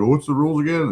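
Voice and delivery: high, playing-dumb whine; a player pretending not to know the rules; mock clueless player. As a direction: ask it like you've never heard of cards. Mocking players who play stupid.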